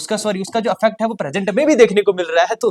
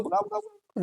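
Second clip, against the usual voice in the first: playful shoo; 10 dB quieter than usual. Acted. Mock shooing the wrong verb.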